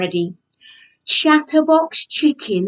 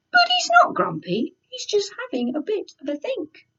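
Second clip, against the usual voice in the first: high reassurance; 11 semitones higher, faster than usual. Acted. Reassuring about grumpy farmer.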